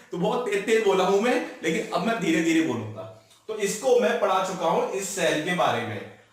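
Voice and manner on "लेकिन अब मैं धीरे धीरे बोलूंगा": ordinary talk, nothing marked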